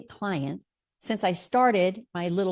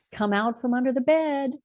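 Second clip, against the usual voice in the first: repeated higher and louder, coaxing; coaxing call; 6 semitones higher, 3 dB louder than usual. Retold or imitated. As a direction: coax her out from under the bed, a bit higher. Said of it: "on my voicemail".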